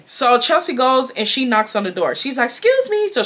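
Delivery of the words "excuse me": polite high voice. Retold or imitated. Chelsea knocking, polite high call.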